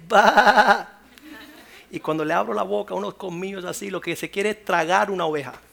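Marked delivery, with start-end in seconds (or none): 0.1-0.9 loud growling wolf bark; wolf roar. Acted. Preacher imitating a wolf entering the church, loud roar. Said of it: "entra un lobo y dice".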